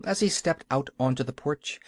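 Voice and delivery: ordinary delivery, nothing marked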